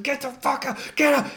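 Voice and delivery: sharp, high shouted command at the horse; yelling. Retold or imitated. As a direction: yell it out to drive the horse off.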